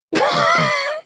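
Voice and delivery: shrill cackling laugh bursting out; high shrieking laugh. Real, not acted. Vlogger laughing at own joke, high shrieking laugh.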